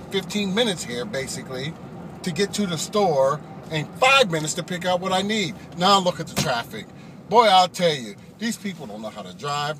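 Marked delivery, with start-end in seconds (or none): none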